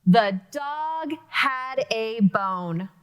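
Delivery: very slow, falling statement; plain statement. Shown as a demonstration. Teacher modeling a statement for the period. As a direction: state it calmly and deliberately. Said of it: "So I said it like I was communicating".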